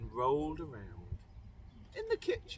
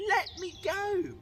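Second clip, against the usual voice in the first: high, loud protest; shouted; 11 semitones higher, 4 dB louder than usual. Acted. Cindy pulling away from the prince, high loud shout. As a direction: shout to break free. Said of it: "as Cindy shouted".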